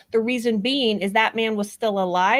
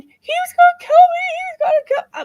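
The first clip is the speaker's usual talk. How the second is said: mocking her voice, much higher and louder, whiny; mock high-pitched whine; 8 dB louder than usual. Host mocking the accused's high-pitched whiny claim. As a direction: whine it like a stressed woman putting it on, up high. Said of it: "get all high-pitched and whiny".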